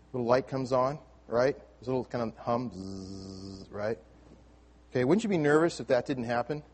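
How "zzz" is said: soft buzzing hum; quiet electronic buzz; imitated hum. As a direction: mimic a computer humming.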